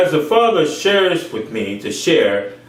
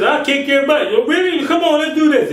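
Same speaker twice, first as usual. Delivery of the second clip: high, taunting voice; mock brawler; 11 semitones higher than usual. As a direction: pitch it way up and taunt. Voicing a fight.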